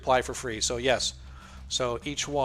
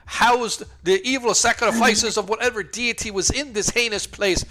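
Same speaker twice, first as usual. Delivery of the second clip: indignant scholar NPC; 7 semitones higher, 6 dB louder than usual. Acted. NPC lecturing about the altar.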